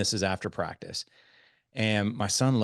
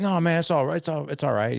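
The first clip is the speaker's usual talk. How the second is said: high, louder mock coach reassurance; breezy reassurance; 8 semitones higher, 5 dB louder than usual. Head coach to a doubtful parent, high breezy reassurance. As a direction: wave the worry off, pitched up. Coach brushes off the narrator's protest.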